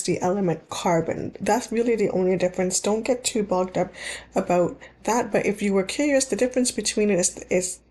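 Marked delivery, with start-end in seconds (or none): none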